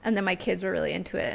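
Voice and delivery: normal talking voice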